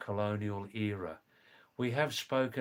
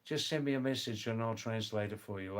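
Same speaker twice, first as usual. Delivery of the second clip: ordinary talk, nothing marked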